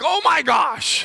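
mock passengers' shocked outcry; astonished gasp. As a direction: passengers gasping in disbelief. Passengers see him as pilot.

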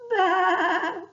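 high bleating baa; high, loud lamb's baa. A narrator's reading. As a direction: bleat like a lost lamb. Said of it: "said the little lamb".

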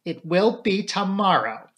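slow, high, decisive announcement; clear voice. A narrator's reading. Daughter defies her mother on the announcement, clear-voiced. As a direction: declare it slowly and distinctly. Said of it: "said Flora in a clear voice".

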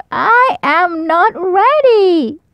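high, slow wailing owlet; crying out. A narrator's reading. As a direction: stretch it into a childish wail. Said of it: "cried Baby Owl".

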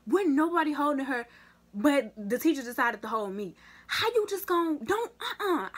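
loud, high indignant storytelling; heated retelling. Real, not acted. A vlogger retelling how a teacher held only her, loud and indignant. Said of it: "I was so mad".

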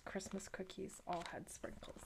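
whispered closing line; whispering. A narrator's reading. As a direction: let the last line fade to a whisper. Whispered final rhyme line.

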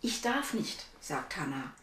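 put-on lively read-aloud voice for children; story narration. A narrator's reading. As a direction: tell the story to little listeners. (Vorlesestimme für Kinder)

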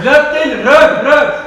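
yelling over music in headphones; shouting, can't hear yourself; too loud, headphones on. Real, not acted.

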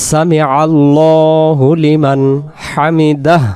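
slow recitation with long-held vowels; prayer recitation. Shown as a demonstration. Teacher showing what follows ruku, measured prayer recitation. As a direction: recite it slowly, sounding the final হ. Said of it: "আমরা কি করব?".

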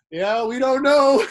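high, loud, slow laughing mock of dad; parents' clueless voice. Son mimics his dad to the DJs, high and laughing. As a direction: play the baffled hippie parent, laughing. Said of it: "they'd be just like".

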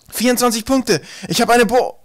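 high, loud boast breaking off mid-word; triumphant shout cut off. A narrator's reading. Twig boasting to both teams, shouting, cut short. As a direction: crow the score, then stop dead. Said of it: "er brach ab".

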